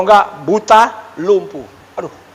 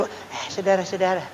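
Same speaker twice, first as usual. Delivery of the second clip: soft, hoarse, croaking voice like a duck; lost voice; 11 dB quieter than usual. Shown as a demonstration. Pastor shows the congregation his voice when it was gone, softly croaking. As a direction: show the crowd how the sick voice sounded, faint and hoarse. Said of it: "Atau sudah seperti".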